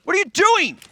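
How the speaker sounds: high, loud shrieked question; hammed-up scandalised cry; mock outrage. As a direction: caster pretending to be shocked at his co-hosts.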